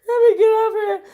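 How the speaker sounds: high and loud, almost crying with laughter; helpless laughter. Real, not acted. A vlogger laughing so hard she tries to regroup. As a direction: squeal the words out through a laugh.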